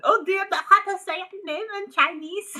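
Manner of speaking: high, loud put-on voice; mocking impression. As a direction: ham up a silly interviewer. Mocking a colleague's question.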